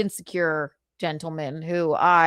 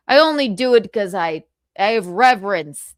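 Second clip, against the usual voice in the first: ordinary delivery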